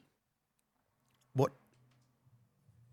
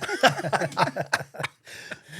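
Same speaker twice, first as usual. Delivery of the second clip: loud high burst of laughter; real loud belly laugh; big laugh; 8 semitones higher, 8 dB louder than usual. Real, not acted. Laughs at his own story.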